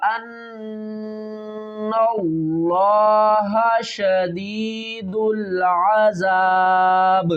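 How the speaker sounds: slow, measured tajweed recitation; Quran recitation. Shown as a demonstration. Teacher reciting the verse slowly for students. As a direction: chant each word with care for the students.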